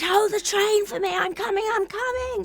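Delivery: high, loud, urgent retold call down the phone; frantic plea. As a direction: beg them to hold the train, breathless with panic.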